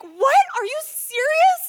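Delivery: very high, loud disbelief; amazed. Retold or imitated. Parents react to son's news on the phone, astonished. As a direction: squeal it in astonishment.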